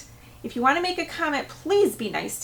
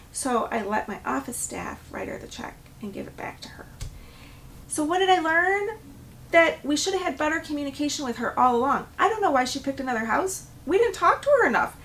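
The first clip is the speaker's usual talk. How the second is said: usual speaking voice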